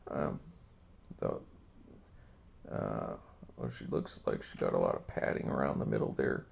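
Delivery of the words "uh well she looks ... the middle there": put-on low, fast gruff-old-man voice; old Bill voice. Old Bill leering at a card, low old-man voice. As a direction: grumble low like a cheeky old codger. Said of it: "old Bill don't mind".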